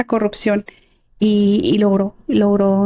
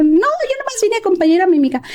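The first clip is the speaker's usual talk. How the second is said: retold startled refusal; 8 semitones higher than usual. Acts out refusing a job offer.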